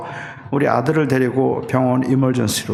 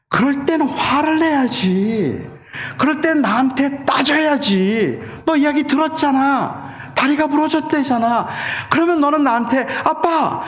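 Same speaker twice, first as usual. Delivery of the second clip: retold angry shout; 12 semitones higher than usual. Father shouting at his injured son in the car, angry and anguished. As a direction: yell it like a father hurt that his son won't complain. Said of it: "소리를 질렀어요".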